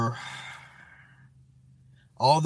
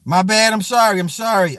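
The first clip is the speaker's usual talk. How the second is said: loud apology; 6 semitones higher, 6 dB louder than usual. Real, not acted.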